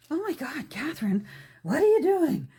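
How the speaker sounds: a pretend horrified fan catching her out; mock gasp. Pretend viewer gasping at her method, mock horror. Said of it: "you might hold your breath and go".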